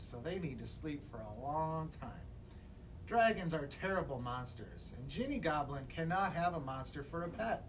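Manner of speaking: ordinary delivery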